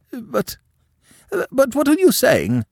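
high, loud breathless disbelief; a shocked aunt who can't believe her ears; gasping in shock. A narrator's reading. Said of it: "she gasped".

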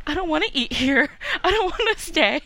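mock a spoiled star who won't stay; whiny celebrity. James whining about eating there.

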